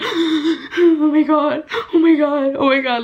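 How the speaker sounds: gasped, high, overwhelmed repetition; freaking out. Retold or imitated. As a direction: re-enact a breathless, overwhelmed freak-out. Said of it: "I was freaking out".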